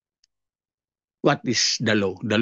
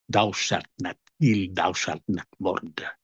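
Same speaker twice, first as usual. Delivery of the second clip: solemn, softer reading of the law; hushed scripture quote; 12 semitones lower, 6 dB quieter, slower than usual. Acted. Preacher quoting the commandment to the congregation, hushed and solemn. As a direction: drop the voice low and soft for the commandment.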